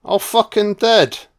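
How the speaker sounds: high squeaky rat cry; hammed-up rat, pitched high; mock dying rat. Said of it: "Rat's like".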